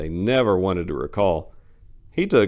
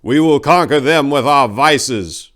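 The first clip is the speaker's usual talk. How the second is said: slow, booming, much higher proclaiming voice; grand motto; 8 semitones higher, 12 dB louder than usual. Acted. Narrator voicing the plaque's motto, slow booming declaration. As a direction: declaim it like a revolutionary slogan. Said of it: "read the plaque over the door".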